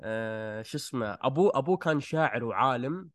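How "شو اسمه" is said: ordinary talk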